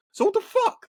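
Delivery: high, loud indignant cry; angry outburst. Real, not acted. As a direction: demand an answer, outraged. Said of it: "I'm just angry".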